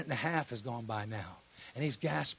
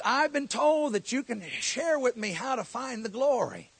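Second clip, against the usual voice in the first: higher, louder, slow pleading; earnest, raised plea to the prophet; urgent plea; 9 semitones higher, 9 dB louder, slower than usual. Retold or imitated. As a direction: beg him to show the way.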